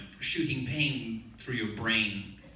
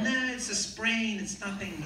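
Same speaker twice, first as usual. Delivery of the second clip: higher-pitched, mock-brave brush-off; 10 semitones higher than usual. Actor denying the break to the nurse, lightly mock-brave. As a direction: wave it away, starting high and drawn out.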